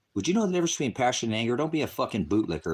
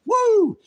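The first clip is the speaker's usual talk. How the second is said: sudden high whoop of triumph; loud whoop; 10 dB louder than usual. Real, not acted. Streamer lets out a loud high whoop at trolls. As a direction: let out a loud, high woo.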